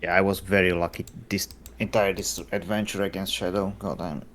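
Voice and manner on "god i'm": normal talking voice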